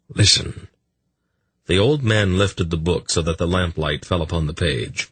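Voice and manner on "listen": whispered. Acted. Old man finds prophecy passage.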